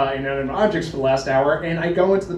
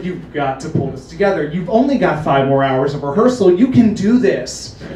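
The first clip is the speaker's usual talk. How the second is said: self pep talk; 8 dB louder than usual. Retold or imitated. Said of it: "And I'm just like".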